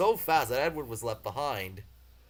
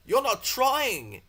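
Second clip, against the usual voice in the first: higher, slow and stern; severe scolding; 11 semitones higher, 6 dB louder, much slower than usual. Acted. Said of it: "they told him".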